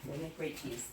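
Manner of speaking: ordinary delivery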